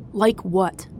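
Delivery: mild angry growl; terse, irritated retort; upset growl. A narrator's reading. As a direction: growl the question back, upset. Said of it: "Seb growled, upset".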